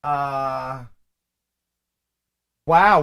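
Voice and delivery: ordinary talk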